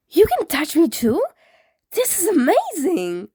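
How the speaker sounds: put-on thrilled the boy can touch him; excited.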